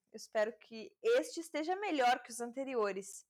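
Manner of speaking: usual speaking voice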